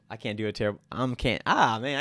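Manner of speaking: usual speaking voice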